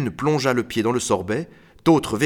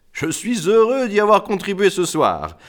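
higher, self-satisfied count; creaking laughing count; 8 semitones higher, 4 dB louder than usual. A narrator's reading. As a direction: gloat like a creaking door. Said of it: "riait comme une porte grince". (comte ricanant, voix grinçante et haute)

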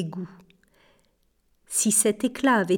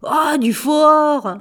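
slow, loud, high disgusted shout; angry curse; 6 semitones higher, 6 dB louder, slower than usual. A narrator's reading. Thief discovers the watch is fake gold, furious shout. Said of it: "grogna un juron".

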